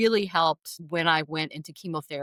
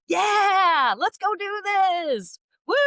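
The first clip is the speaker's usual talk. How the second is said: much higher, louder retold whoop, slow and stretched; yelling pep; 5 dB louder, much slower than usual. Husband on the way to chemo, retold as a coach's whoop. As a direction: holler like a coach firing up the team. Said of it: "he hooped and hollered, and he started yelling like".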